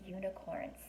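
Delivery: ordinary delivery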